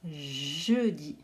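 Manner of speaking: "Jeudi" with a long "Jjj" at the start; "Jeudi", first consonant drawn out; exaggerated first sound. Shown as a demonstration. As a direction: hold the opening J of "Jeudi". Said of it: "on entend le je".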